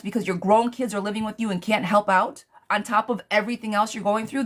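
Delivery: ordinary talk, nothing marked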